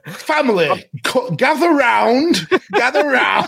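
high, slow, pompous proclamation; grand announcement. Pretend. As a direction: announce it like royalty. Mock grand family announcement.

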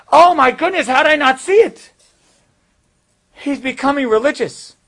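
high, loud gasp of dawning shock; a father's stunned realization; sudden realization. Retold or imitated. Father at steakhouse suddenly realizing the truth, high shocked exclamation.

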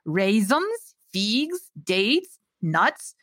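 put-on lilting Slavic-accented list. A narrator's reading. Old baker lists her ingredients, Slavic accent. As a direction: count them off in a Slavic accent. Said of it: "The voice was heavily accented".

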